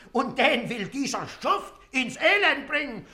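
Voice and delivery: ordinary talk, nothing marked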